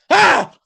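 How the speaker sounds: sudden high, loud yelp of fright; startled yelp. Acted. Young monk hearing a voice in his head, startled yelp. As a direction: jolt out a startled cry. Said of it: "Like that".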